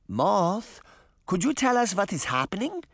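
put-on high, questioning old-woman character; high granny question; grandmother's voice. A narrator's reading. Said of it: "Granny asked".

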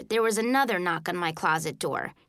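usual speaking voice

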